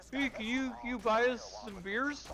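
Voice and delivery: high, slow mock-kid pleading; wheedling kids. Younger college kids asking for beer, mock wheedling.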